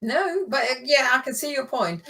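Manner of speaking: ordinary talk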